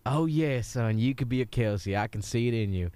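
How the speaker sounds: mild Southern coach voice, easygoing; a Southern football coach giving a rousing talk; Southern accent. Acted. Coach telling the kid about walkout songs, in a mild Southern accent. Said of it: "let's just both be Southern".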